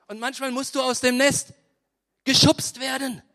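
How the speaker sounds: emphatic preaching. Acted. Preaching with force.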